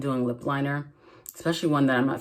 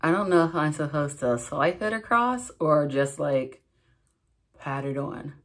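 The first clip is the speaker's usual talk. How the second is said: lips parted. Real, not acted. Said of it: "so I'm gonna sound funny for a few".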